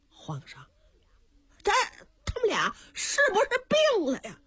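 high, fast bewildered eunuch; a nervous servant whispering doubts; puzzled eunuch. Acted. Eunuch asks the emperor if the dwarfs are ill, high and fast.

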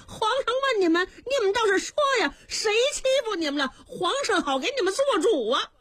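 high, fast fussing eunuch voice; a flustered old eunuch pleading; anxious eunuch. Acted. Chief eunuch presses the dwarfs to speak, high and hurried.

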